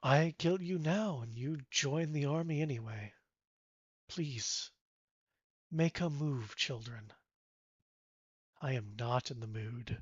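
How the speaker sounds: icy warning to the crowd; cold menace. Acted. Godfrey threatens the armed humans, quiet menace. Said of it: "Godfrey looks around and goes".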